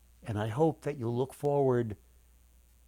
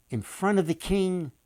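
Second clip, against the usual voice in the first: ordinary delivery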